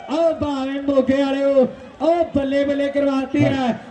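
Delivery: ordinary talk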